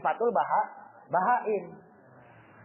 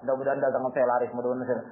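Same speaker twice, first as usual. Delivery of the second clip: hopeful prayer; 9 semitones lower, faster than usual. Pretend. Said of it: "Terus saya doa".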